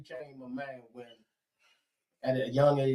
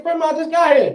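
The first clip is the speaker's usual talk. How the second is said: high, loud whine; protesting kid; 10 semitones higher, 9 dB louder than usual. Retold or imitated. Child protests to grandma about fetching a switch, high loud whine. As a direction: plead like a child just arrived. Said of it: "I said".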